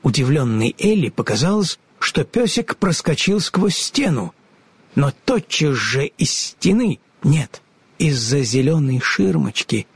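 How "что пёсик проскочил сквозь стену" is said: ordinary delivery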